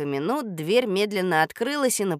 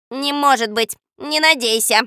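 parrot voice; 6 semitones higher, 8 dB louder than usual. Acted. Попугай Жако дразнит.

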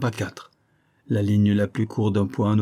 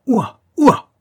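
high, loud barking woof; a dog answers with two loud yaps; 14 semitones higher, 9 dB louder than usual. Acted. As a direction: bark like a happy dog. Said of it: "fit Isaac en remuant la queue".

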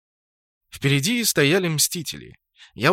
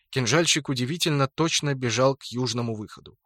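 ordinary delivery

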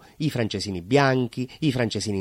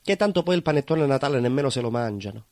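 normal talking voice

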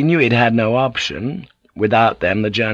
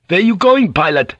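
high, louder worried question; anxious; 10 semitones higher, 5 dB louder than usual. Acted. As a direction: call out anxiously to the pilot. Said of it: "Van Neck said anxiously".

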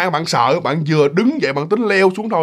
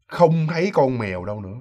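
ordinary delivery, nothing marked

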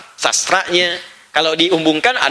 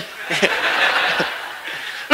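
a short breathy laugh at his own joke; soft chuckle, amused; quiet laugh; 6 dB quieter than usual. Real, not acted. Preacher amused at his Bandung joke, soft chuckle.

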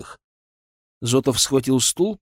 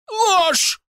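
a much higher, louder shriek; 4 dB louder than usual. A narrator's reading. Panicked merchant shrieks back at his accuser. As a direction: squeal the word in panic. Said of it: "взвизгнул купец".

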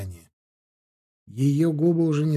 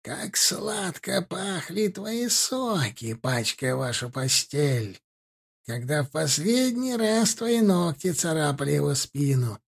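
gurgling crone voice; 2 semitones higher, 3 dB quieter than usual. Acted. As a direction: savour each taunt like a hag. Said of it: "спросила старуха".

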